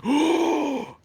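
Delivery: high, thrilled oh; excited gasp. Retold or imitated. A kid at the meeting sees drama start, high excited oh. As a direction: squeal in delight.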